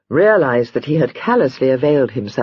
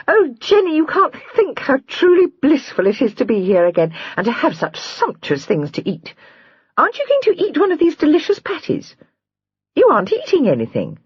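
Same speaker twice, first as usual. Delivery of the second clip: put-on much higher, bubbly young sister; gushing young girl; 12 semitones higher than usual. A narrator's reading. Lydia gushes to Jenny over the lobster patties. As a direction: chatter on with sweet delight.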